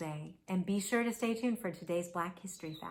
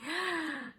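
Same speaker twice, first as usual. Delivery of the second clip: high surprised gasp; sharp gasp; 9 semitones higher, 3 dB quieter than usual. Acted.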